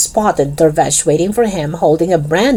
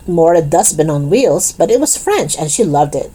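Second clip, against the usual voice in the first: ordinary delivery, nothing marked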